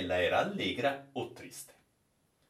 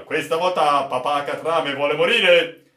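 loud joking captain; 5 semitones higher, 10 dB louder than usual. Acted. Captain jokes loudly.

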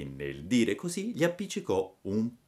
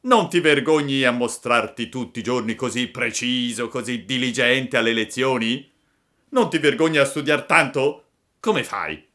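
louder, animated scolding of a classmate; schoolboy bully scolding; 5 semitones higher, 7 dB louder than usual. Acted. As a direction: shame him like a resentful schoolmate. Boys scolding Pinocchio for studying. (monello che rimprovera)

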